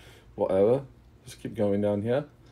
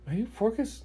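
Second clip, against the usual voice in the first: high character voice; 13 semitones higher, 5 dB quieter, slower than usual. Acted. Character snaps at the party.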